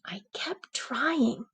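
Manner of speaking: whispered, slow and soft; hushed whisper. A narrator's reading. Storyteller reading to children, dropping to a whisper. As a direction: breathe the words out quietly. Persistence, quiet narration.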